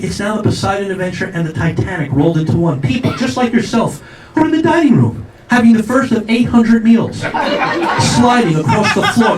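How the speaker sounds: usual speaking voice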